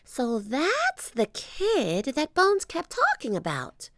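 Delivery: put-on high, slowish little girl voice; a curious kid piecing it together; young girl. Annie tells Jack, in a high girl's voice.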